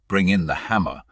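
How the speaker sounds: usual speaking voice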